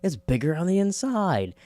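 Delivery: mock amazement at the tardis; awed surprise. Belinda stepping into the tardis, lightly mock amazed. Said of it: "that moment of being like".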